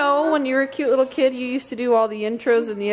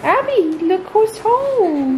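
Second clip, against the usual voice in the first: welcoming someone home; 7 semitones higher, much slower than usual. Real, not acted. Mother greets child, welcoming home.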